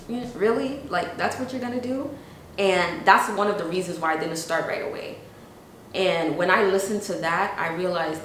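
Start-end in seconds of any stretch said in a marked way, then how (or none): 0.1-2.1 high, sceptical questioning; imitating a dubious listener, pitched up; doubtful disbelief. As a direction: question it like you doubt their plan. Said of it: "they kinda looked at me like".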